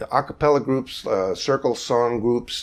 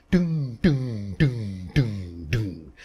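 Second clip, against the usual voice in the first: ringing "doom" like a plucked string, fading out; plucked upright bass "doom", dying away; resonant bass pluck; much slower than usual. Shown as a demonstration. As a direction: let each "doom" ring and fade like a bass string. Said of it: "when you pluck the string of an acoustic bass".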